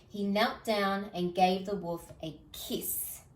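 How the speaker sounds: ordinary delivery, nothing marked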